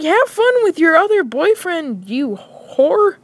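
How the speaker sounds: high, spiteful send-off; mock bitter retort. Boy to girlfriend mid-breakup, mock bitter retort. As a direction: fling it back in hurt anger. Acted breakup quarrel on phone.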